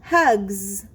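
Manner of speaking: Hugs with a long buzzing z at the end; teacher modelling a word. Shown as a demonstration. Teacher modelling the word for young readers. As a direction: model Hugs, holding the final z. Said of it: "The next word is hugs".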